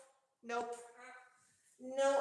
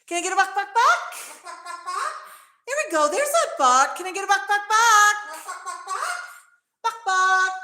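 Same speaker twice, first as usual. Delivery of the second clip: high, loud sing-song buck buck, coaxing the bird; chicken clucking for the parrot; 6 semitones higher, 16 dB louder than usual. Pretend. Owner coaxing her parrot Max to copy, with loud high clucks. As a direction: cluck it out like a hen to get him going.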